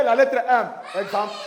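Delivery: ordinary delivery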